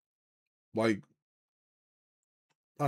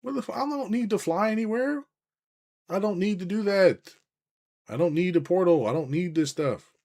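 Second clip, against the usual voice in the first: high, exasperated disbelief at the whole idea; mock outraged dismissal, higher and louder; incredulous rant; 10 semitones higher, 6 dB louder than usual. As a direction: scoff at it in a raised pitch. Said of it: "I'm like".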